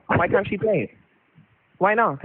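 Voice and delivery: ordinary delivery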